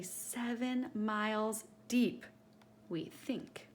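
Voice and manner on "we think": plain reading voice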